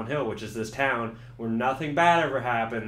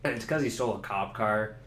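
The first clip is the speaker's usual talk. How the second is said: ordinary talk, nothing marked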